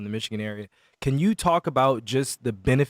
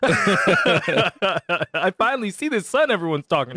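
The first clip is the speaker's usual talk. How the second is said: laughing mock wonder; 7 semitones higher, slower than usual. Joking as Russian seeing sun.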